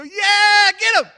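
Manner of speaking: very high, full-out cheer; crowd cheering. Retold or imitated. Townsfolk cheering against Tyre, shouting. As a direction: roar approval like an excited mob. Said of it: "And everybody cheers".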